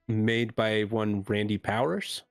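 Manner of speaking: ordinary talk, nothing marked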